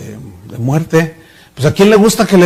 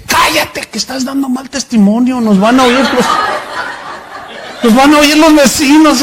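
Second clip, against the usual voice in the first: angry hushing; 7 semitones higher than usual. Retold or imitated. Abusive deacon hushing his wife. (regaño furioso exagerado, agudo)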